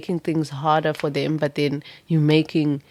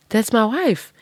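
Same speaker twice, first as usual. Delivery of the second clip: excited exclaim; 6 semitones higher, 4 dB louder than usual. Pretend. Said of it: "And they're like".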